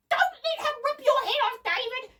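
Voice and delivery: very high, loud shriek; girl's frantic high cry. A narrator's reading. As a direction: shriek the warning in panic. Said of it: "shrieked Debbie".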